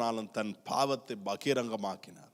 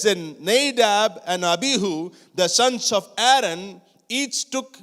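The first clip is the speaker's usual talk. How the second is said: scripture reading; 8 semitones higher, 6 dB louder, much slower than usual. Acted. Reads scripture aloud to congregation.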